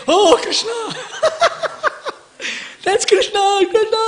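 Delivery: high-pitched mock scream, breaking into laughter. Kamsa seeing Krishna in shiny things, mock screaming. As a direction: scream it out in hammed-up alarm. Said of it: "He's screaming".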